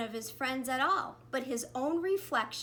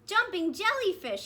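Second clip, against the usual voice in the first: higher, louder squeal; delighted squeal; 8 semitones higher, 6 dB louder than usual. A narrator's reading. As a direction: squeal with joy. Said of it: "he squealed".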